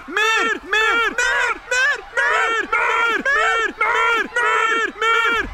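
very high, relentless squeaky chant; voices closing in. Acted. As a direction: hound Bear with tiny shrieking voices. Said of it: "komen de stemmen steeds dichterbij". (heel hoog, opdringerig dierenkoor)

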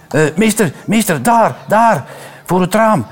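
put-on high, urgent child's voice; child alerting the teacher, high and excited. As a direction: point and call to teacher. Child points out the dog.

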